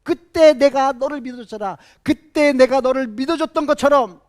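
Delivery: Barnabas's words pour out loud and high; nearly shouting what he held back; bursting out. Retold or imitated. Barnabas answering Paul in his heart, near shout. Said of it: "그 말이 여기까지 막 이렇게 나왔을".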